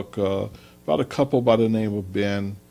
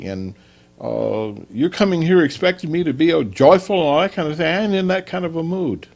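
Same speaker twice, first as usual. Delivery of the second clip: higher, louder, defensive complaint; irritated husband; 7 semitones higher, 4 dB louder than usual. Acted. Husband back from a hard day, fending off his wife, irritated and raised. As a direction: push back at her like a stressed husband.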